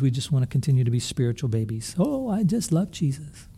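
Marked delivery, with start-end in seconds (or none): 2.0-3.5 higher, softer, slow mock-sweet gush. Preacher mimics a spiritual baby, softly and slowly. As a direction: imitate a naive new believer, slow and soft. Mocking spiritual babies' naive talk.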